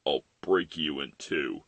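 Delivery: low, soft growled threat; menacing hero. Acted. Hero threatens the villain, low and menacing. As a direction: drop low and threaten quietly. Voices Batman threatening Joker.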